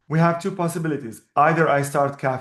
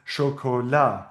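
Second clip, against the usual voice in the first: chocolat stressed on the final LA; last-syllable stress; 4 semitones lower, slower than usual. Shown as a demonstration. Teacher explaining the French word, chocolat with final stress. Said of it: "the stress is on the last syllable".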